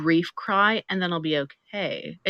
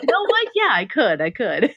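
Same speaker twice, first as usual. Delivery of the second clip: mock brave reply; 5 semitones higher, 7 dB louder than usual. Acting herself answering mom.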